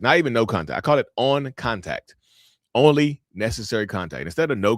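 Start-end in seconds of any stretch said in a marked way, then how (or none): 0.8-2.1 "on" stretched out on a higher pitch; stress on "on". Shown as a demonstration. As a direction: lean slowly on "on". Repeats coined term against "no contact.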